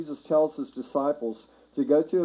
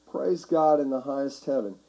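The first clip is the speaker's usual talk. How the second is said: usual speaking voice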